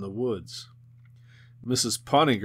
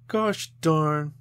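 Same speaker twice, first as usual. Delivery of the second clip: frustrated, raised outburst; angry; 6 semitones higher, 5 dB louder than usual. A narrator's reading. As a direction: snap it out in frustration. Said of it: "she said angrily".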